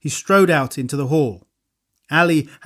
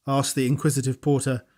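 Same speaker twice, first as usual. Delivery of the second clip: ordinary delivery, nothing marked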